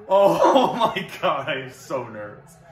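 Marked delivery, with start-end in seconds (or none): 0.1-1.5 slow, louder, laughing through it; real giddy laugh; laughing disbelief. Real, not acted.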